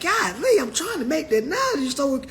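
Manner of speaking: lightly hammed-up frustration; exasperated high voice. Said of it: "I'm like".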